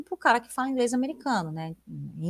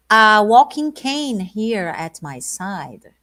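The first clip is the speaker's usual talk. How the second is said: slow and louder, each word clear; slow, projected lyric reading; 7 dB louder, much slower than usual. Shown as a demonstration. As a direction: read it out slowly to the class. Reads lyric slowly, louder.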